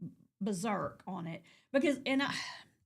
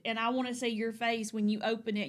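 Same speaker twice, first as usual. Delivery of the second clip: ordinary talk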